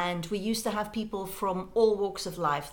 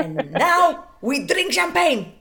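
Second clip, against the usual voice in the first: slow, louder, higher mock thug voice; mafia heavy; 6 semitones higher, 8 dB louder, slower than usual. Mafia man announcing the next drink, slow mock thug. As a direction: drag it out as a heavy-handed decree. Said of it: "And then they would go".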